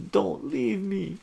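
high, loud plea; pleading cry. A narrator's reading. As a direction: beg the departing girlfriend to stay. Hero pleads as girlfriend leaves.

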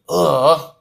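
exasperated groan. A narrator's reading. Girl told to fetch her brother, groaning. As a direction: let out a fed-up groan. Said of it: "Anastasia groaned".

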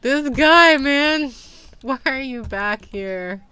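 high, loud complaint; exasperated yell. Real, not acted. Player complaining about the returned customer, exasperated yell. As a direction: exclaim it loudly in dismay.

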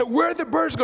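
high-pitched exasperated question; comically frantic search; mock exasperation. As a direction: call out in hammed-up bewilderment. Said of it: "becoming a little more exasperated".

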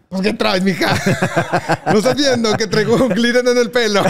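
giggly, sassy brush-off; laughing quip. Retold or imitated. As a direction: tease her while cracking up. Retold quip to a dancing girl.

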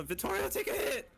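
high, pleading yell; urgent demand. Real, not acted. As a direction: beg a teammate to take the hit. Urging teammate mid-chase.

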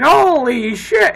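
loud, high, drawn-out disbelief; mock shock. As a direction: exclaim it high and slow, aghast. Reacting to people on the show.

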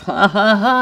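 loud high mock laugh; big barroom laugh; bursting laugh; hearty laugh. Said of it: "I went to laugh".

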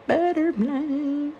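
Butterfly sung out high and slow; high slow singsong Butterfly; singsong name. Shown as a demonstration. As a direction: float the word Butterfly up high, drawn out. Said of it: "It is called Butterfly".

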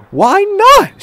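high, loud shocked question; gasping surprise. A narrator's reading. Woman reacts to Thomas not celebrating, gasping in surprise. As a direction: burst out in high-pitched disbelief. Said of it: "she gasped".

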